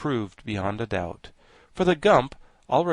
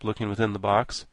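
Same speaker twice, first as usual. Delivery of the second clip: ordinary delivery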